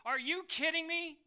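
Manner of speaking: high, loud mock incredulity; mock disbelief. Preacher mocking the doubters, high and loud disbelief. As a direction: scoff at the critics' complaint. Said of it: "and say".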